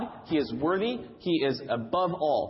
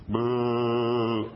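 mock crowd boo; 5 semitones lower than usual. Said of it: "when the Steelers come out".

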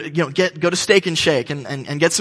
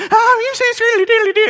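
much higher, louder, cartoonish singsong; silly opener; 6 dB louder than usual. Mimicking the opening act's goofy crowd call. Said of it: "this guy who's like".

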